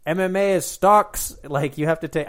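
half-hearted French Canadian impression of the fighter; French Canadian accent. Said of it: "I can't do a terrible Fr-, uh, Canadian, French Canadian accent".

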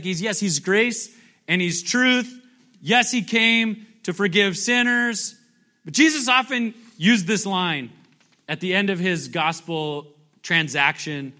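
ordinary delivery

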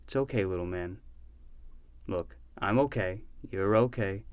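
calm him gently; soothing comfort. Acted. Uncle soothing injured boy.

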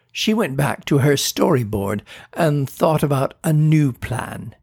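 plain reading voice

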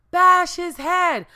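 loud, high, slow command; blunt instruction. Retold or imitated. Property manager tells her how to kill the rat, loud slow order. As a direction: spell out the gory order.

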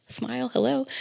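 a hint of a forced smile; fake cheerful. Fake cheerful greeting continues.

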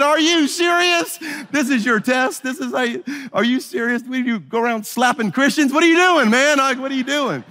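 high, disbelieving outcry; can't believe it, voice way up; incredulous. Retold or imitated. To the friend who slapped him, high incredulous disbelief.

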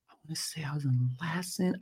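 hushed whisper to self; under the breath, barely voiced; whispered aside. Real, not acted. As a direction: think aloud in a real whisper, trying to recall. Thinking aloud, recalling the street.

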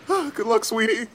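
high, tearful goodbye; acted choked-up well-wishing; wiping away tears. Dad wishing his departing daughter luck, tearful. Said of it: "he's wiping away the tears".

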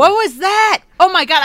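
startled shout. Real, not acted. Startled by own voice clip.

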